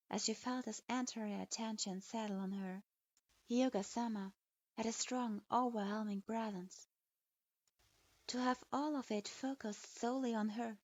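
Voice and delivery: usual speaking voice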